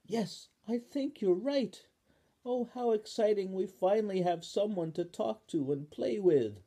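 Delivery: excited Spoon. Acted. Spoon agrees with Ball, high and delighted. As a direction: agree happily.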